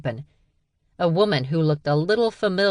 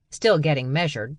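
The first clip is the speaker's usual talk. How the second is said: ordinary talk, nothing marked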